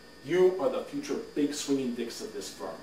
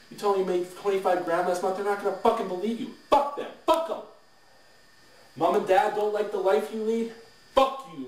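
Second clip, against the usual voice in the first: high, loud, heated contempt; defiant tirade; 8 semitones higher, 4 dB louder than usual. Acted. Boss tells trainees to ignore doubting parents, loud and defiant.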